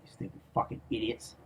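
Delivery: soft hissed insult; contemptuous whisper. Real, not acted. Whispering an insult at the enemies who can't find it.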